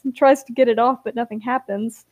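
plain reading voice

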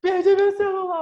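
very high, loud hammed-up crying voice; mock tearful wail. Imitating crying friend phoning about lost phone, mock wail. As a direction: wail it like a drunk in tears. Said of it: "chorando muito".

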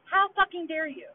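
escalating furious retort; indignant outburst. Real, not acted. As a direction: fling it back at her, outraged. Angry rant, repeated and raised.

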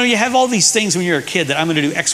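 normal talking voice

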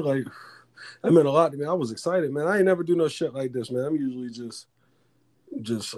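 ordinary talk, nothing marked

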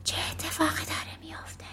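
quick, high whisper; softly asking. A narrator's reading. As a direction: whisper the question urgently. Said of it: "لوندر آهسته پرسید".

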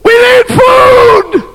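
high, slow shouted plea for food; starving woman crying out; desperate cry. Acted. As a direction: beg the king at full voice. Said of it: "She cried out".

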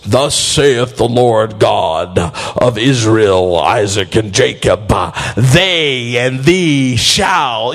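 deep, slow King James prophet voice; grandiose prophecy. Retold or imitated. Preacher mocking a grand prophecy, deep and slow. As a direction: intone it like an old-time prophet.

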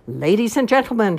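announcer shout. Acted. Grand marshal addressing the racers, announcer shout. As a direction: boom it to the crowd. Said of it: "she shouted through a megaphone".

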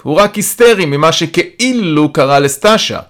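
dismissive calling. A narrator's reading. Calling dismissive answer to Yaron.

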